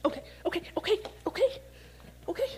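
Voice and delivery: calm the crowd with quick rising okays; excited okays. Real, not acted. Preacher calming children who throw things at him.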